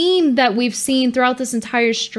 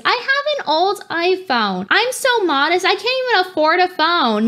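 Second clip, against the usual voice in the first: higher, fake-modest whine; mock modest voice; 7 semitones higher than usual. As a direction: whine it like someone faking poverty. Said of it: "She brings that up every five seconds".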